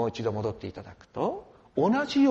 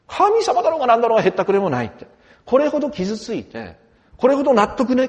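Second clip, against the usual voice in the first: loud, fast and higher, a preacher acting out a furious man; raging outburst; 7 semitones higher, 9 dB louder than usual. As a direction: shout it like someone past caring about God. Voicing a vengeful man's rage. (怒り狂った男を大声で演じる)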